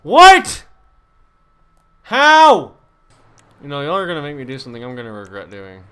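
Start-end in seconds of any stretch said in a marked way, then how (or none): 0.0-2.8 very high, loud one-word outbursts; disbelieving shout. Real, not acted. Gamer baffled by the game, disbelieving shouts. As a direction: yell each question in disbelief.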